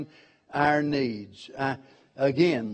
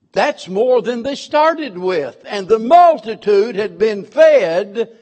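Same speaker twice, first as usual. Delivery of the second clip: preaching louder; 5 semitones higher, 7 dB louder than usual. Real, not acted. Preacher rising at miracle.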